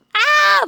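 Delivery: very high yelp of fright; sudden high scream; panicked scream. Acted. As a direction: shriek as the ghost gets near. Fleeing from the ghost.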